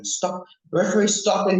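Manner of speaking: replaying a fan's yell for the stop; retold scream. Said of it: "I was screaming".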